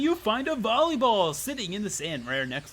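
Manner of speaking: big, loud storyteller reveal; animated game narration; story narration. A narrator's reading. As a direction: open high and strong, then settle. Reads game narration aloud.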